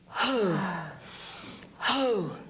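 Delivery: long forceful exhale; martial exhale. Shown as a demonstration. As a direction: release it into the cut. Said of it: "commit this to a thought to cut".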